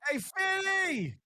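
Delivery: high drawn-out call of hey fifty; stretched high callout. Retold or imitated. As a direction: sing out the name slowly, high. Calling out to [name].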